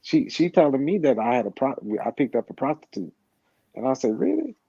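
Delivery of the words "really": "Really?" jumped way up high in stunned disbelief; a second "Really?" pitched far higher than the first; incredulous really. Retold or imitated. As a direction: squeak out "Really?" as if you can't believe it. Repeats reaction, much higher.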